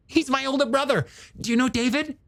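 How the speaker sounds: high, animated character voice; eager younger brother. Acted. Hooper greeting his brother's old girlfriend, high eager character voice. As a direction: play a keen, friendly scientist, pitched well up.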